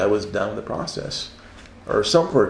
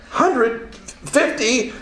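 torn between two prices; frantic haggling; 13 semitones higher, much slower than usual. Retold or imitated. Late at night, torn over the book price, flustered haggling. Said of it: "and I'm like".

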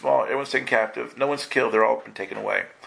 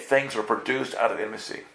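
ordinary talk

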